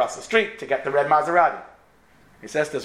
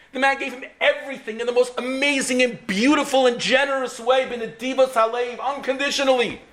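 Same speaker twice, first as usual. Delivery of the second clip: impassioned preaching; 10 semitones higher than usual. Acted. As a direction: drive the lesson home with fervor. Preaching the moral.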